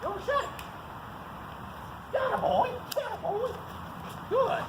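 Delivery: squeaky high dog praise; high excited praise for the dog. Real, not acted.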